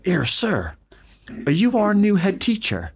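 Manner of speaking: put-on Bristolian accent. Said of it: "I'll do my best Bristolian accent".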